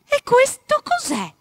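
high, loud, slow surprised cry; wife suddenly finding the rabbit, surprised; surprised exclamation. Acted. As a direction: gasp it out in discovery. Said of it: "esclamò all'improvviso".

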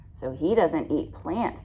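usual speaking voice

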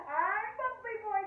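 high, loud announcement; heroic declaration. A narrator's reading. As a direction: declare it proudly at full voice. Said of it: "Sam declares".

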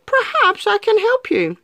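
high, honeyed sly fox; sly fox greeting the gingerbread man with a grin; grinning fox. Acted. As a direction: charm the little cookie with a grin. Fox offers a ride.

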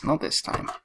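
whispered repeat. Shown as a demonstration. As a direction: breathe it out as a whisper. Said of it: "but not this time".

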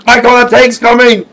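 high, loud warning; alarmed shout. Real, not acted. Player warning friend of a tank, alarmed shout.

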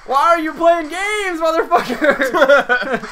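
high, slow, laughing accusation; mock-outraged, laughing at him; laughing outrage. Real, not acted. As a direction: laugh it out at your teammate. Teammate trolling, laughing outrage.

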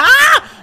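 very high, loud ah; startled scream. Real, not acted. Gamer cut off by a hit, startled scream. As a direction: scream as the blow interrupts.

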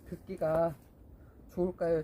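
usual speaking voice

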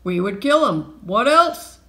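loud thief's threat, rising at the end; thuggish threat. A narrator's reading. Thief replying to his partner, loud and menacing. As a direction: answer loudly, as if it's obvious.

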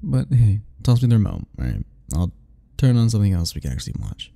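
normal talking voice

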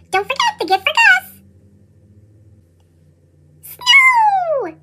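a tiny mouse in a hurry; squeaky mouse. Acted.

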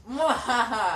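hammy villain cackle; evil laugh. Pretend.

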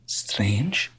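a puzzled whisper, unvoiced and low. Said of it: "and says".

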